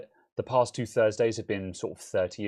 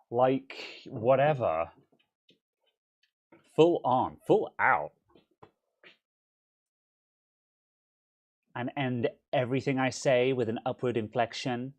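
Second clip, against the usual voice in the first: a hint of a mock American accent; American valley girl; 2 semitones higher than usual. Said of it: "That's my American bitch not vo-, uh, voice".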